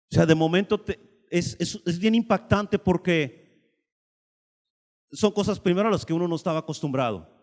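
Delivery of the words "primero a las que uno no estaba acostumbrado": ordinary talk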